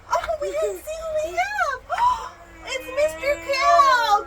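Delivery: high-pitched playful surprise; excited reveal. Pretend. Mother revealing the last toy to child, excited. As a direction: build suspense, then stretch out the reveal.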